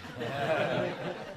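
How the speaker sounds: high airy unvoiced giggle; soft playful laugh; breathy giggle. Real, not acted. Laughs after reassurance.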